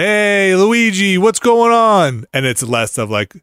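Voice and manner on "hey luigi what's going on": put-on higher, louder Brooklyn-accented greeting, slow at the end; a light Brooklyn Mario voice hailing his brother. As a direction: call out to Luigi like a guy from Brooklyn. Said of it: "I think you just do Brooklyn".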